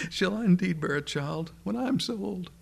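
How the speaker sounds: high-pitched, amused incredulity, as if chuckling; hammed-up old woman tickled by an absurd idea; Sarah, chortling in disbelief. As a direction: ask it through a private chortle, voice lifted high. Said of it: "kind of chortling to herself".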